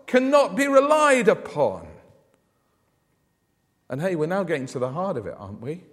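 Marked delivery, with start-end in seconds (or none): 0.0-1.9 higher and louder, voicing the grumbling Israelites; mock complaint. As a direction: blurt it out as a doubting grumbler, pitched up and loud. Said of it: "They, they were saying".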